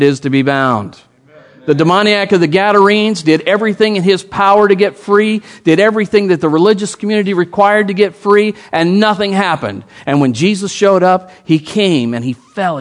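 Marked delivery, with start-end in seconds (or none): 1.6-12.8 preaching. Acted.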